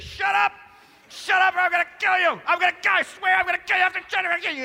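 high, loud furious mock scream at a child; hammed-up parent losing it in the car; screaming at the kid. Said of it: "You're screaming your head off at the kid".

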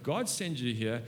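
ordinary delivery

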